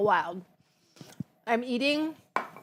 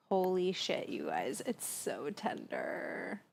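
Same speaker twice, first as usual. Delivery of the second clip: awed hush; 3 semitones lower, 11 dB quieter, slower than usual. Real, not acted. Tasting food, awed.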